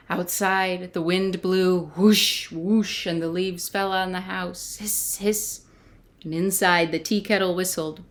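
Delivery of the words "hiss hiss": soft hiss. Acted. Quiet hissing sounds.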